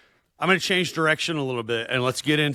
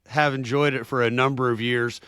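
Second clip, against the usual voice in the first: usual speaking voice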